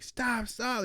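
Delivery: high-pitched mock yell, held back in volume; half-voiced high yell; retold mom's yelling. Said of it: "my mom is just yelling".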